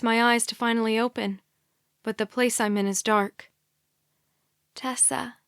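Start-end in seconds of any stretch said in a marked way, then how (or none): 4.7-5.4 soft, motherly whisper; almost-mom tone, whispering to the waking girl; whispered name. A narrator's reading. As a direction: breathe her name gently. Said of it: "almost mom tone whispers".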